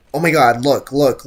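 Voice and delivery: usual speaking voice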